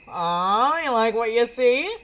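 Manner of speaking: loud, drawn-out playful tease; teasing. Acted. Frankie catches Jack watching the workout, teasing. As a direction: tease them with a slow, rising question.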